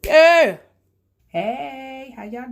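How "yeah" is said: loud high yell of yeah; big excited yeah; hype shout. Acted. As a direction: shout it like the crowd's going wild.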